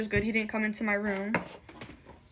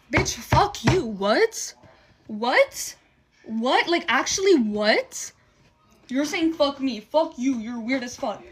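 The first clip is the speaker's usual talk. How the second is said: real angry outburst; 2 semitones higher, 4 dB louder, slower than usual. Real, not acted. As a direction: fire back, outraged and in disbelief. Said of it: "low-key just made me mad".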